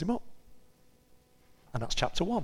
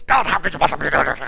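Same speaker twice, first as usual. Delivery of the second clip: ranty prayer; 8 dB louder, faster than usual. Retold or imitated. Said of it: "Ranty sort of".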